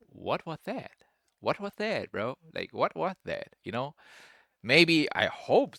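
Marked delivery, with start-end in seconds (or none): 0.1-3.6 higher-pitched imitation of a baffled partner; puzzled mock complaint; mock disbelief. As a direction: quote them in mild, incredulous dismay. Imitating women's dismayed reaction.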